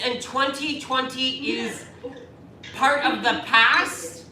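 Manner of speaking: slow, weighty farewell; slow solemn announcement. Real, not acted. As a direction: draw each word out solemnly.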